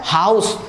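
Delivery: हाउस said with the h pushed from the belly; हाउस with a strong belly h; pron demo. Shown as a demonstration. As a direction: breathe हाउस out from the stomach. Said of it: "देखिए कैसे पेट काम कर रहा हमारा".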